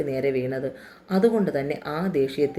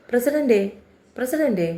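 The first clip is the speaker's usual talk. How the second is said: calling out from the yard; 8 semitones higher than usual. A narrator's reading. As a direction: call പ്രസിഡന്റേ up toward the house, pitch raised. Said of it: "മുറ്റത്ത് നിന്ന് വിളിച്ചു കൂവി ജയ".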